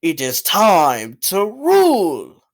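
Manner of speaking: slow, grandiose villain proclamation; mock-dramatic villain voice, heavily drawn out; hammy villain line. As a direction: drag out each big word theatrically. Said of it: "he has terrible lines such as".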